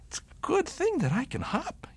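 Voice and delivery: high whispered bunny voice; whispered bunny thought. A narrator's reading. Bunny hopping through the snow, high whispered. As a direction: whisper it, pleased with himself.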